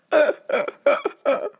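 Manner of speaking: high laughing burst; real laugh at his own joke. Real, not acted.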